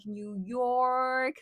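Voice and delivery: exaggerated New York accent. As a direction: say it like a born New Yorker. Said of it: "the way you say New York in a very New York accent is like".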